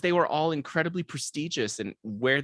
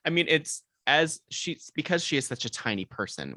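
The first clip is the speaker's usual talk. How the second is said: normal talking voice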